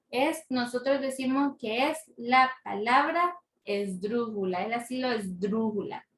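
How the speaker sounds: ordinary talk, nothing marked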